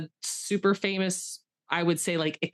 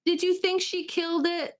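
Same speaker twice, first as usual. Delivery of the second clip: mock disbelief; 12 semitones higher, 4 dB louder than usual. Real, not acted. Teasing friend's claim of success.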